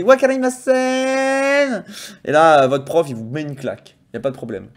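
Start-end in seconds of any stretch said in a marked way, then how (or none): 0.0-1.8 high, slowed puzzled student voice; mock the teacher with fake confusion; drawn-out playful I-don't-get-it; clueless student. Shown as a demonstration. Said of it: "vous faites un petit".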